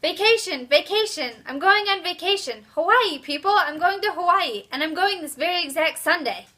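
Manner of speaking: loud, high-pitched excited announcement; excited yelling. Acted. Girl announcing her vacation to the class, excited yelling. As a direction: yell it out, punching each burst. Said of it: "She yells".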